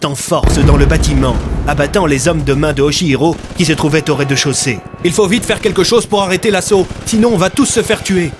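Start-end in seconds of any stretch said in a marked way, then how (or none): 5.1-8.3 high, urgent warning; alarmed inspector, pitch raised throughout; exclaiming in alarm. Acted. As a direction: warn them in rising panic. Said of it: "s'exclama Bloom".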